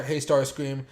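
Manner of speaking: ordinary talk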